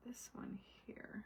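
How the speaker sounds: hushed, slow aside while concentrating; whispered to herself. Real, not acted. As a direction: whisper "this one here" slowly to yourself. Murmuring to herself while crafting.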